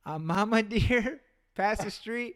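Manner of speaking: slow, words broken by a laugh; can't hold back the giggle mid-phrase; laughing through it. Retold or imitated. Retold again, laughing through it.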